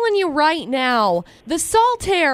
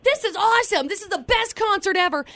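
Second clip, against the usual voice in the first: higher-pitched excited gushing; retold elation; 6 semitones higher than usual. Concertgoer recalling the [name] show, retold elation. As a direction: gush like a thrilled fan. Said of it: "such a high for me".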